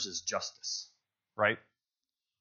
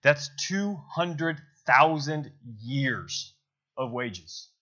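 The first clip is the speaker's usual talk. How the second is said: two hundred thousand years stretched out slowly, higher; slow amazed emphasis; 4 semitones higher, much slower than usual. Real, not acted. Preacher stunned by the debt, speaking slowly. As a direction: let the huge number sink in. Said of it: "slow emphatic amazement".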